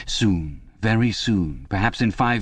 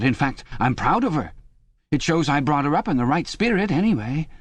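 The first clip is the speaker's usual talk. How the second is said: much higher, eager, anxious chap's voice; a frightened man begging for reassurance, pitched up high; Parsons, nervous and pleading; 7 semitones higher than usual. Acted. Parsons begging Winston in the cell, high anxious pleading.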